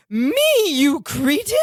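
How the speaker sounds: mock outrage from an affronted dowager; hoity old lady. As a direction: put on a haughty, insulted old lady. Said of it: "And she says".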